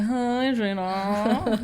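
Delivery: "Hi, Gina" slurred and stretched out, slow; mumbled self-mimicry. Host mimics how they sound on playback, mumbled self-mimicry. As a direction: draw "Hi, Gina" out into a lazy slur. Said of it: "I'm always like".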